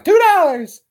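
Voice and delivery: high, loud quoted catchphrase; movie line quote. Acted. Quoting Better Off Dead, high loud movie voice. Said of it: "That was his favorite line".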